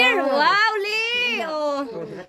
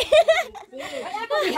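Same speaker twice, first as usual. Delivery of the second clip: a quick high laugh; amused high laugh; high giggle; 5 semitones higher than usual. Real, not acted. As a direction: laugh at the gift. Laughing at Lia's dress.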